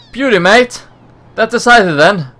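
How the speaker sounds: high, cheerful Aussie voice; hammed-up Australian cheer; Australian accent. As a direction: celebrate the new mateship. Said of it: "I can't really hold a accent".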